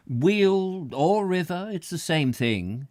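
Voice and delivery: slow, generous, indulgent; magnanimous. A narrator's reading. Squales indulging Mrs Jan Bill, slow and magnanimous. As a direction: grant her point graciously. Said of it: "he said magnanimously".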